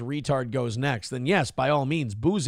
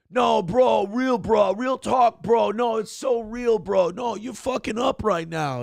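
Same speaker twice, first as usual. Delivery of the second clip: East Coast bro; 6 semitones higher than usual. Retold or imitated. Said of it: "impression of the dumbest fucking American".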